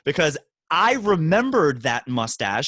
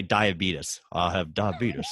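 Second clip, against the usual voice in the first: put-on low, soft old-man mumble; deep, quiet put-on old-timer; Wilford Brimley impression; 9 semitones lower, 6 dB quieter, slower than usual. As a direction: grumble it like a tired old man. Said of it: "Wilford Brimley would just say".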